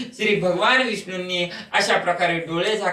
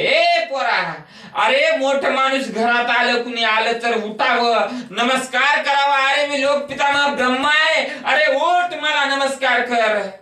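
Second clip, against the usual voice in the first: indignant Brahma; 7 semitones higher, 3 dB louder than usual. Acted. Brahma demands respect.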